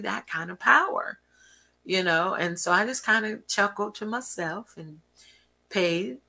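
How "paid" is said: ordinary talk, nothing marked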